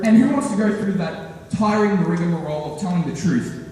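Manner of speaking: ordinary talk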